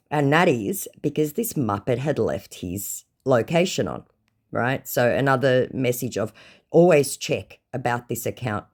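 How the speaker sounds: ordinary talk, nothing marked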